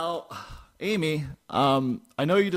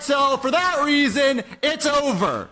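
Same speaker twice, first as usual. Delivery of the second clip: angry; 8 semitones higher than usual. Acted. Breaking up with girlfriend on air.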